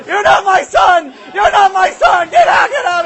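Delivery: screamed at high pitch, full volume; hysterical mother. Acted. Mother disowns her son, hysterical screaming. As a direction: shriek each line in a rage. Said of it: "and she's like this".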